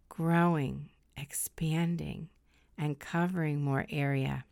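slow, soft meditation voice; soft spa whisper. Acted. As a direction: guide them into calm, slowly. Leads a guided meditation.

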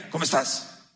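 a low, curt, unfriendly ¿Cómo estás?; cold greeting. Shown as a demonstration. Pastor showing the cold way to greet someone, curt and low. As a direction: greet them like you barely care. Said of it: "No es lo mismo que digan".

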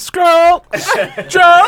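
excited joke. Real, not acted. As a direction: sell the punchline. Joke punchline, rising shout.

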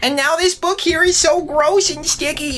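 very high, exasperated squeak; a squeaky little undead complaining; squeaky flustered Limden. Acted. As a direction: panic upward into a squeaky protest. Limden voice continues.

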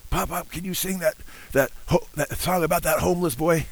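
lightly voiced little boy begging Pop; cute little grandson. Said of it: "in his cute little raspy voice".

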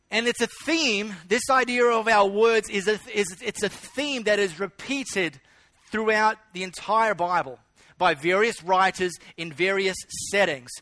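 ordinary talk, nothing marked

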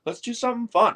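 high, loud bro voice bursting with excitement; hyped-up Rory impression. Friend urging him to explore New York, high excited bro voice. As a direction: play the buddy itching for trouble, laughing.

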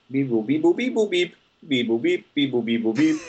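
high robotic beep-boop chant; toy robot voice. Pretend. Calculating, mimics a calculator.

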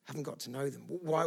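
ordinary delivery

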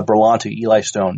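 normal talking voice

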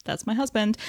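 a soft, dreamy swoon, lightly done. Retold or imitated. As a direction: coo it lovestruck, lightly. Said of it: "Finn is just like".

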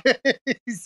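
a quick bright burst of laughter; short laugh. Real, not acted. Host laughing at his own Brits joke, short laugh.

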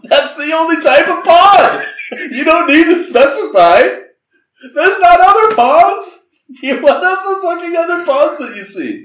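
high, loud, exasperated yell; shrieking mock outrage at the script; mock rant. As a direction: rant at the screenwriter. Ranting at the movie's wording.

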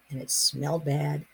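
usual speaking voice

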